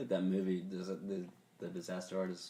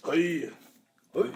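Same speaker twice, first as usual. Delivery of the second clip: high mock-Japanese samurai call; 6 semitones higher, 7 dB louder than usual. Shown as a demonstration. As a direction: show off a Japanese character voice. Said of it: "I have a good Japanese voice".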